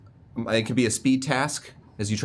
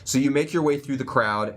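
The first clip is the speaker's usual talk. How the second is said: ordinary talk, nothing marked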